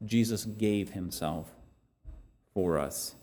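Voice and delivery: a quiet echo of the key line; soft repeat. Acted. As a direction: drop the voice and let it land gently. Preacher repeats point softly.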